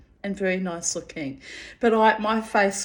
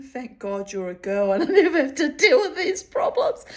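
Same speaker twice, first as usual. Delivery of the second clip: put-on imagined old man's thought; 5 semitones higher than usual. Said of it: "I could see him thinking".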